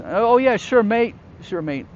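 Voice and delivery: high, loud mock British accent; badly faked cheery British "mate" voice; terrible British accent. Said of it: "There goes that British accent".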